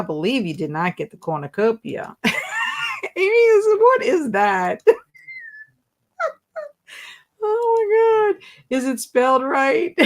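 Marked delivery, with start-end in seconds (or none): 3.2-4.8 high, loud cry of surprise; surprised shout. Real, not acted. Host reacting to a viewer's strange guess, surprised cry. 7.4-10.0 high, slowed oh my God; amazed. Real, not acted. Host stunned by a correct spelling, amazed.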